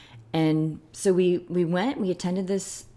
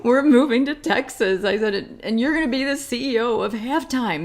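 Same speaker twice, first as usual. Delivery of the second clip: excited; 6 semitones higher, 5 dB louder than usual. Retold or imitated. Retold excited announcement.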